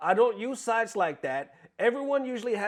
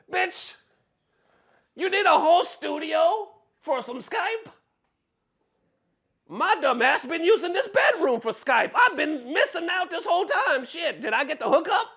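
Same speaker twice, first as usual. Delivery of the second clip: 1 incredulous mockery; 12 semitones higher, 6 dB louder than usual. Real, not acted. Mocking the scammer's studio excuse.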